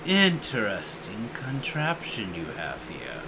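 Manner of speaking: low, soft dragon musing; a great beast sizing up the ship; low, hushed dragon aside to the crew. Acted. GM voices the landed dragon.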